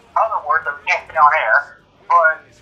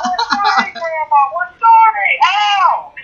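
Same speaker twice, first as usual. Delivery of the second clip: high mock-childish apology, rising to a shout; scolded kids to grandma, high and whiny; kids apologizing; 13 semitones higher, 4 dB louder, slower than usual. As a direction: whine sorry like scolded kids. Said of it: "What did I tell you".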